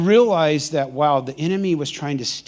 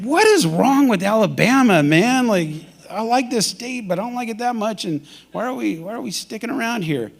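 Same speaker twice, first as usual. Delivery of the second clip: exasperated; 5 semitones higher than usual. Retold or imitated. Retold frustration at being stranded.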